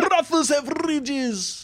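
high, slow mock accent, sounding Jamaican; hammed-up Jamaican-sounding accent with rolled R's; botched accent sounding Jamaican. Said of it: "I made him sound Jamaican".

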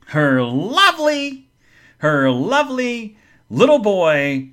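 slow, drawn-out "lovely"; mock-adoring, stretched out; sarcastic sweetness. Real, not acted. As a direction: gush it slowly with heavy irony. Sarcastic praise of the wailing child.

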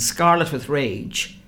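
ordinary delivery

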